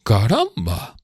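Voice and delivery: dry, ironic caramba; voicing Drumley's ironic caramba; ironic exclamation. A narrator's reading. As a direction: say it with irony. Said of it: "exclamó con ironía".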